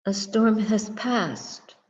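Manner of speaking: usual speaking voice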